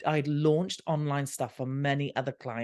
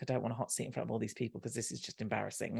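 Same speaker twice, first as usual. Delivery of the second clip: softer, fast anxious mutter; dreading inner voice; 4 semitones lower, 9 dB quieter, faster than usual. Retold or imitated. Dreading a hot seat before the crowd, softer quick mutter. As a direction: rush it under your breath, dreading the spotlight.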